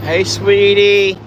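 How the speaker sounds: high, slow cooing greeting. Real, not acted. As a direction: coo it sweetly up high. Farmer greeting cow tenderly.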